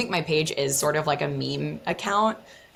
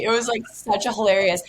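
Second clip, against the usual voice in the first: ordinary talk, nothing marked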